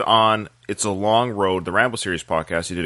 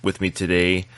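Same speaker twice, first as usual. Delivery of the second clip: ordinary talk, nothing marked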